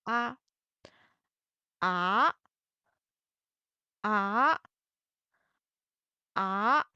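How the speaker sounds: each "Ah" climbs like a question, second tone; rising tone. Shown as a demonstration. Teacher drilling second tone on the final, rising "Ah". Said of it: "put the second tone on the final".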